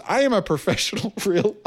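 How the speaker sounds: high, haughty reading breaking into a laugh; mock her self-importance, then crack up; indignant email tone. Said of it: "can't believe the tone of this".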